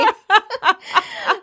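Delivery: high, bright burst of laughter; real laugh. Real, not acted. Host cracking up at the recapped principal scene, laughing.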